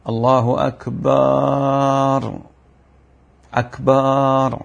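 "أكبر" said as "akbaar", the ba vowel drawn long, slow chanted; drawn-out ba in أكبر, sung slowly; muezzin's error, أكبار with a stretched ba; mispronounced akbar. Shown as a demonstration. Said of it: "مد الباء في أكبر".

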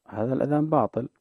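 ordinary delivery

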